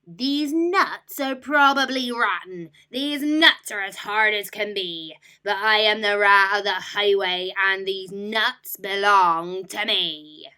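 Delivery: loud, sneering robber rat; scornful rat. Acted. Rat scorning the rabbit's clover, loud sneer.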